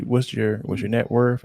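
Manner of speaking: usual speaking voice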